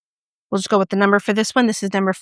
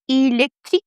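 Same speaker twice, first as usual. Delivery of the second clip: attempted French; 4 semitones higher than usual. Shown as a demonstration. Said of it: "or as we in America say".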